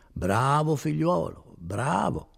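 slow, coaxing praise; smooth and mannered. A narrator's reading. Notary humouring his prisoner Renzo, unctuous. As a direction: draw out the praise, ingratiatingly. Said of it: "rispose il notaio tutto manieroso".

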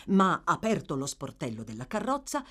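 usual speaking voice

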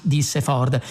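ordinary delivery, nothing marked

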